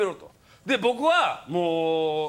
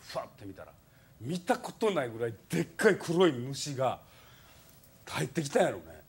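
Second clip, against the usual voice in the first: normal talking voice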